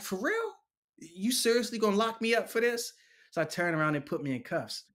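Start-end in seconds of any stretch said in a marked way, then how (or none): 0.0-2.9 much higher, incredulous rise; can't believe it; disbelief. Retold or imitated. Prisoner protesting to the lieutenant in disbelief. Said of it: "And I'm like".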